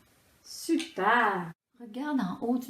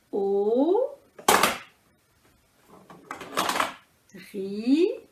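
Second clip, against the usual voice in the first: very slow "Auriculaire", each syllable held apart; much slower than usual. Shown as a demonstration. Teacher counting with balls, syllable by syllable. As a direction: stretch "Auriculaire" into four beats with long gaps. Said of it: "quatre syllabes".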